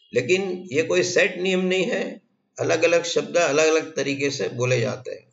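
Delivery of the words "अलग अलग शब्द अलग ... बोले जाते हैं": usual speaking voice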